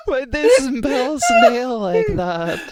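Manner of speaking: high, slow words through laughter; laughing hysterically. Real, not acted. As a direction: squeal it while cracking up. Laughing fit.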